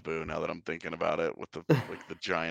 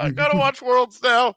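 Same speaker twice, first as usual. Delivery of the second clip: high, loud excited squeal; 11 dB louder than usual. Retold or imitated. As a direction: squeal it like a thrilled fan. Retold excited reaction.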